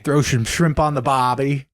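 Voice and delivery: playful Australian catchphrase voice; Australian accent. Shown as a demonstration. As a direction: put on a stock Australian accent. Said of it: "what else they say".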